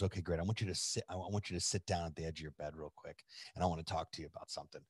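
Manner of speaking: low, soft, intimate request; gently sit someone down for a serious talk; hushed seriousness. Retold or imitated. Friend turns serious, retold.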